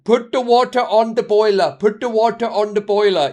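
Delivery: high, loud put-on Indian accent; hammed-up Indian accent, shouted. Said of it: "I can't do the Indian accent".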